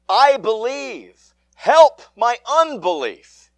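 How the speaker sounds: loud, high, slow desperate plea; cried out. Acted. Desperate father crying out to Jesus, loud pleading cry. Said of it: "the father of the child cried out".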